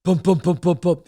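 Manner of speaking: punchy, rhythmic thumping syllables; footsteps imitation. Storyteller mimics dad walking down the steps, rhythmic thuds. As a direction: voice heavy steps coming downstairs. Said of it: "He's walking down the steps".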